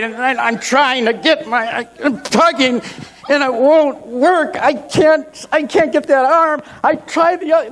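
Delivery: high, effortful, broken-off phrases; acted frantic struggle, pitch climbing; straining panic. Man tugging at a stuck shirt, straining in panic.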